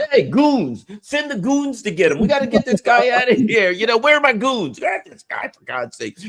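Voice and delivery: high, loud mock bossy voice calling for goons; mocking politician. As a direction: lampoon a pompous politician barking for security. Mocking a congressman calling his goons.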